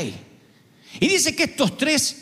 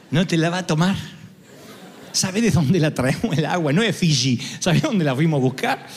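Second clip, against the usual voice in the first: lower, laughing disbelief; laughing protest; 5 semitones lower than usual. Acted. The soldiers protesting to the king, laughing.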